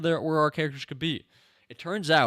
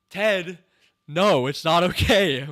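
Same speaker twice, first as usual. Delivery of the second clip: loud, slow scolding, raised pitch; hammed-up outraged telling-off; exasperated shout; 5 semitones higher, 12 dB louder, slower than usual. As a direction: yell at him as if he's clueless. Mock scolding of the show's character.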